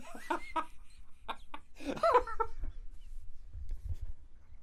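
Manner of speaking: long, high-pitched laughter; laughing fit. Real, not acted. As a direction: crack up helplessly, high and long. Cracks up at the wordplay.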